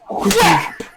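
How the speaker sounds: sharp high yelled whap; shouted smack sound. Retold or imitated. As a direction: blurt the impact noise loud. Impact sound in the story.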